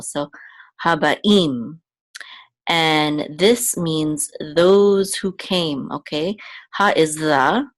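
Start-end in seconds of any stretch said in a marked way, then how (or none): 0.8-1.8 Haba'im with a stressed, rising final im; stress on last syllable. Shown as a demonstration. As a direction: land firmly on the im of Haba'im. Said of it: "accent of this word is on the last syllable".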